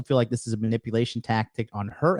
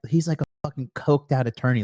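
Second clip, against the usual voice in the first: normal talking voice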